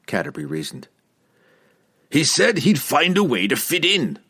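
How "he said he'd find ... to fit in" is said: higher-pitched defensive dwarf; defensive, animated protest. A narrator's reading. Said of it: "Bruner protested".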